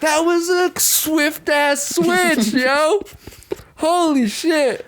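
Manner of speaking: high, slow, stunned exclamation; shocked viewer. Retold or imitated. Reacting to the film's twist, mock stunned and high. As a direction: drag it out in disbelief. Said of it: "And you're like".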